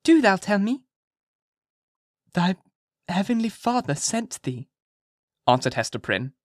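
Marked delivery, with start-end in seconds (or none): 0.0-0.9 put-on high, earnest child voice; high little-girl insistence; serious child. A narrator's reading. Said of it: "seriously coming up to Hester". 2.3-4.7 slow, high, halting answer; hesitating mother, drawn out; hesitant. A narrator's reading. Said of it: "she said it with a hesitation".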